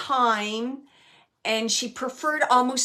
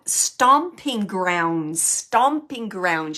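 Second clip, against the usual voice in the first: stomping grounds stretched very slowly; slow drawn-out words; 6 dB louder, much slower than usual. Retold or imitated. Medium relaying a spirit's fond memory, very slow. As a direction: draw out each syllable, relishing the memory. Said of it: "She's saying it like that".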